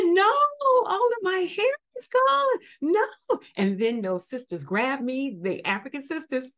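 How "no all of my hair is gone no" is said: much higher, louder wail of despair; shocked woman wailing as her hair falls out; distraught crying. Retold or imitated. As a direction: cry it out in shock and grief. Hair falling out in braids.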